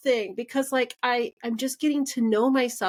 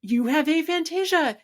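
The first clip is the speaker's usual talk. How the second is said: mock triumphant diagnosis, pitched higher; husband diagnosing her, excited higher voice; excited announcement; 7 semitones higher than usual. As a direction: announce it like a discovery. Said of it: "And he's like".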